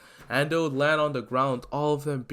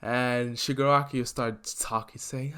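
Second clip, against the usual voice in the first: ordinary talk, nothing marked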